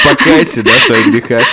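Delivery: high, loud, laughing command to repent; mock solemn. Host teasing the guest about her husband's plea, laughing. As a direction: jokingly demand a confession, through laughter.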